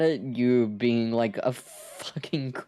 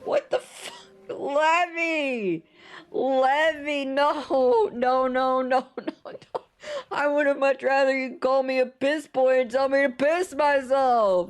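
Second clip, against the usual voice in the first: shrieking protest; 13 semitones higher than usual. Real, not acted. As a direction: squeal in mock horror at the gift. Streamer shrieks at gifted subs.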